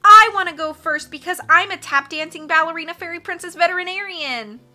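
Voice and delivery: high, loud, bratty whine; whining. A narrator's reading. Carmelita demanding to go first, whining loudly. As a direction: demand it like a spoiled little girl. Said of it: "Carmelita whined".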